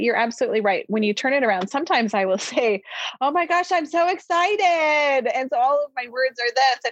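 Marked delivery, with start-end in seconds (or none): 3.2-6.9 high, drawn-out fake excitement; over-the-top sarcastic enthusiasm. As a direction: gush with mock excitement, stretching the words. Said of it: "I feel like you're being sarcastic".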